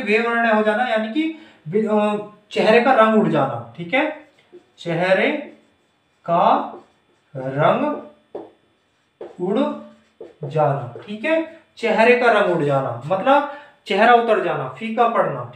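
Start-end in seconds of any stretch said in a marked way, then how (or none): 4.8-10.9 one word at a time, drawn out; slow dictation. Shown as a demonstration. Teacher dictating a meaning to students, slow dictation. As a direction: pause after each word so it can be written.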